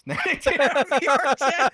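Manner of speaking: giggling through the words; laughing. Real, not acted. To friends about VR mishap, laughing.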